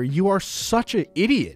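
normal talking voice

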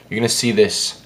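normal talking voice

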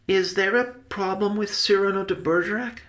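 high-voiced curious nephew; child-like questioner. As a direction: ask innocently in a high, light voice. Benny questions his uncle.

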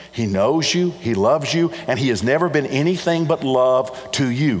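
ordinary talk

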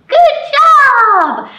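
very high, loud cheer; excited praise. Real, not acted. Librarian cheering the children after the song, very high.

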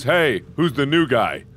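ordinary talk

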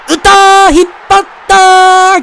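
high, loud, breathless home run call; breathless rising goal commentary. Acted. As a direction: scream it out as the ball leaves the park.

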